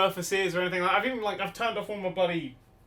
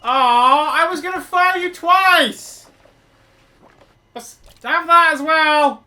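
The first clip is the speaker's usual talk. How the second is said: mock whine; 8 semitones higher, 11 dB louder, slower than usual. Mock disappointment at bot.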